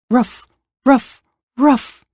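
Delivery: short mild woofs; barking dog. A narrator's reading. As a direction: lightly imitate a dog's bark. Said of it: "each of the animals joined in".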